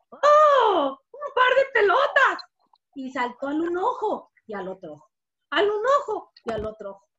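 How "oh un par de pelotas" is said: high, loud exclamation of wonder; delighted discovery. Acted. Fly spots two eyes as balls, thrilled. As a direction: marvel at a thrilling find. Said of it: "regresó a ver y dijo".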